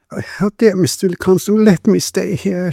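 high, pleading woman's voice; tearful lisp. A narrator's reading. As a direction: beg through tears in a high pitch. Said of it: "she lisped through her tears".

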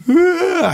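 high, loud acted "ah"; overcome cry. Retelling falling down in church, overcome cry. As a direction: let out a high cry as if knocked over.

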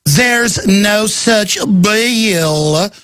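slow, drawn-out cartoonish denial; mocking cartoon voice; cartoon character. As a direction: stretch it out like a cartoon villain. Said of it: "like a cartoon character".

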